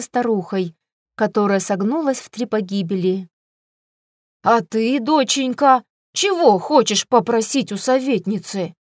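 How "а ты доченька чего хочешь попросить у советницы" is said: plain reading voice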